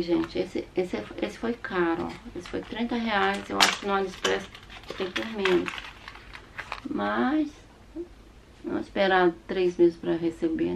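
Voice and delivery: ordinary talk, nothing marked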